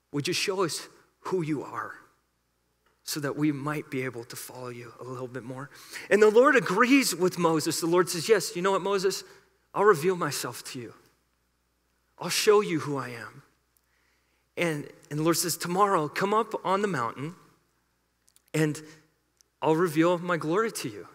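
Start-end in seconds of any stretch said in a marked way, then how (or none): 0.1-5.7 much softer and lower, almost breathed; hushed prayer. Acted. Pastor voicing the church's prayer, softly and low. 8.3-21.1 gently voiced Lord. The Lord promising Moses, lightly voiced and soft.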